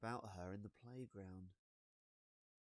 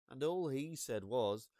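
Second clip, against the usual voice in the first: ordinary delivery, nothing marked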